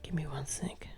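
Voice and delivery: quick whispered aside. Real, not acted. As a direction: whisper it to yourself while searching. Muttering while searching, unvoiced.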